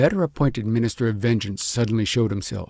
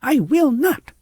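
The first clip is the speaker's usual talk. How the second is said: high, loud defiant scream; 13 semitones higher, 6 dB louder, slower than usual. A narrator's reading. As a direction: scream the refusal. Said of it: "screamed the princess".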